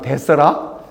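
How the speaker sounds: a short Jeolla-dialect "it's all right". Shown as a demonstration. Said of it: "전라도 말로".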